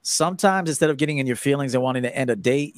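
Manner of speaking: usual speaking voice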